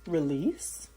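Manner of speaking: very slow, stretched words with long pauses; calm, sustained yoga nidra voice; guided breathing meditation. Acted. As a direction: draw out each inhale and exhale cue. Leads a counted breathing meditation.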